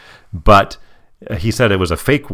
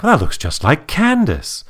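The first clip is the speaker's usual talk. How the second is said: lightly voiced character, pitched up high; imitating Lawrence; 8 semitones higher than usual. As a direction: retell his line in a high, put-on voice. Voicing Lawrence's line in retelling.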